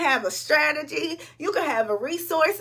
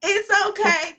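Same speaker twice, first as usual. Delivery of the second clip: higher and louder, crying through it; teary reassurance; 5 semitones higher, 5 dB louder than usual. Real, not acted. Telling her aunt it's okay, tearful. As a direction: brush off the tears for her aunt. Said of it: "I didn't mean to cry".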